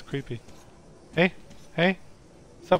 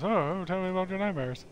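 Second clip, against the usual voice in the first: slightly higher, softer mock concern; lightly voiced listener; 4 semitones higher, 5 dB quieter than usual. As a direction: play at coaxing out a nightmare. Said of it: "And then he goes".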